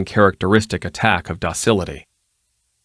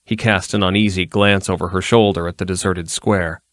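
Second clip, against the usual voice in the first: ordinary delivery, nothing marked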